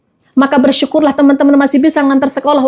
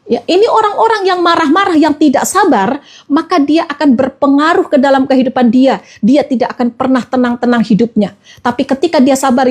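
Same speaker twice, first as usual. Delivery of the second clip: usual speaking voice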